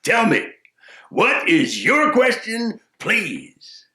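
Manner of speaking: lightly voiced sage, slow and inviting; wise man. Wise man inviting the question, slow. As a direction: coax the question out.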